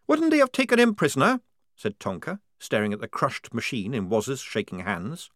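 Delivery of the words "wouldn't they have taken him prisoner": high, loud questioning recruit; doubtful young recruit. Acted. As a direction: push back with doubt. Tonka voiced.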